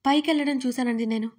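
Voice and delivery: ordinary delivery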